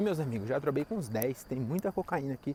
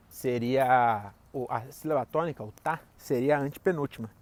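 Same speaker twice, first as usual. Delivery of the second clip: usual speaking voice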